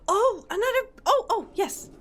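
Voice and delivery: high delighted gasps; thrilled at a find; excited. Real, not acted. Finds hidden compartment.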